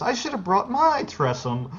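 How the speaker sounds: high-pitched lady's voice; put-on high voice for a passing lady; lady bystander. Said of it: "one of the ladies nearby says".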